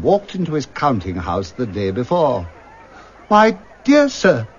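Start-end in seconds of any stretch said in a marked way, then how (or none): 3.3-4.5 higher, louder, slow welcome; warm Scrooge. Acted. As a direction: greet an old acquaintance gladly. Scrooge greeting the gentleman.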